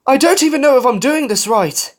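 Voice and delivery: high, loud anxious babble; fretful self-doubt. A narrator's reading. Falcon fretting loudly, hears nobody.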